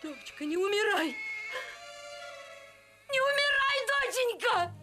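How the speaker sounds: high loud sobbing plea; wailing. Acted. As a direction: beg through grief. Mother wails over dead daughter. (рыдающий крик)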